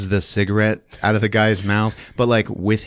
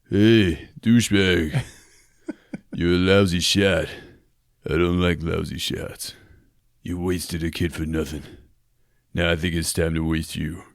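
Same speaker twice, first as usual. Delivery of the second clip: mock tough cop; 3 semitones lower than usual. Cobra taunting the gunman, low deadpan growl. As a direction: play it cool and menacing, low.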